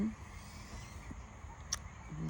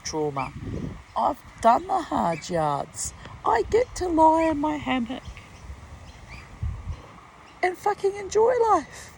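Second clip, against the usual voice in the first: louder and higher, building to near-shouting; rising defiant outburst; 5 semitones higher, 7 dB louder than usual. Real, not acted. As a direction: climb from firm to shouting triumph. Defiant rant about earning her rest.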